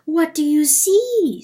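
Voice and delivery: high, loud intoned question; dream voice. A narrator's reading. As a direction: demand it high and loud, like a haunting voice.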